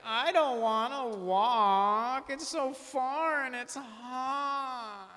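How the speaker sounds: high, slow, whining mock woman; whiny hint. Man imitates a woman hinting for a ride, slow high whine. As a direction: drag it out in a sulky, self-pitying whine. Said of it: "They gotta be like".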